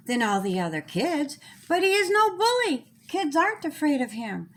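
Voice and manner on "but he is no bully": usual speaking voice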